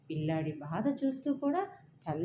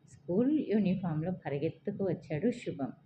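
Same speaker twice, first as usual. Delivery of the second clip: ordinary delivery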